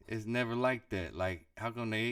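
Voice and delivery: usual speaking voice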